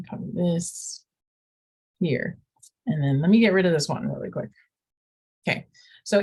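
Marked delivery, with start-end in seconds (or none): none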